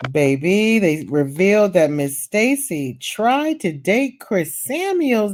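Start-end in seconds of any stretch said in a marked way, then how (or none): none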